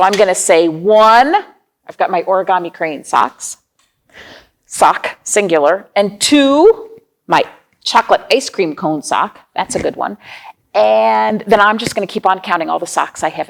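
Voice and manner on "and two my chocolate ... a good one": ordinary talk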